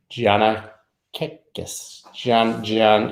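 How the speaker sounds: very slow, careful "Giannakakis"; halting name attempt. Real, not acted. Streamer reading a viewer's name, "Giannakakis" in a slow, halting attempt. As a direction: sound out "Giannakakis" piece by piece, unsure of it. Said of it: "I hate trying to pronounce people's names".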